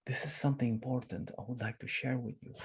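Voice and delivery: soft confiding hush; secretive tone. Shown as a demonstration. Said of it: "It's this tone of secrecy".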